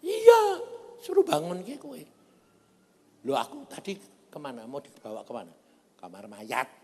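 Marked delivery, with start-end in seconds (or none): 0.1-2.0 high, loud acted comeback; animated, exclaiming quote; exclaimed retort. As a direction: throw the answer back in a high, raised voice. Acts out answering the woken person.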